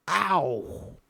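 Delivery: high drawn-out howl that falls away. Acted. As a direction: howl up to the sky. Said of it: "howling to the sky".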